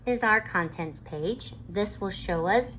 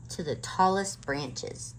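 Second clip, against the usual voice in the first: usual speaking voice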